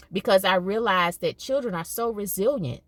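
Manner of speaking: usual speaking voice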